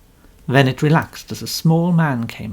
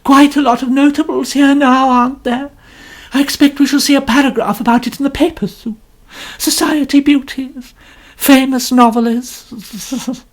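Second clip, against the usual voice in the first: much higher, louder complaining lady; complaining novelist; 11 semitones higher, 7 dB louder than usual. A narrator's reading. Mrs Otterbourne boasting to Poirot, high and complaining. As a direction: preen and grumble at once. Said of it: "went on in a complaining voice".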